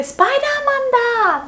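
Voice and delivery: very high, excited squeal; surprised. Shown as a demonstration. Fan sees Spider-Man show up, squealing high. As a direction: shriek with amazement at Spider-Man. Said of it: "if a Spider-Man shows up".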